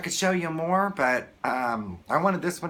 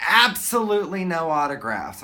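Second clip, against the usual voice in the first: imitated guard's warning, raised and louder; lightly mimicked official announcement; stern bodyguard; 4 semitones higher, 5 dB louder than usual. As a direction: hit the first word hard like a guard. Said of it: "this gentleman kept walking back and forth".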